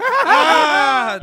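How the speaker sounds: loud, high cry breaking out of laughter; real laughing howl at a joke; laughing scream. Real, not acted. Guest reacting to the chicken tenders joke, laughing scream.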